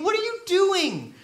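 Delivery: high-pitched mock disbelief; incredulous challenge. Onlooker questioning a friend yelling at fires, mock incredulous. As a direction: challenge them, pitched well up.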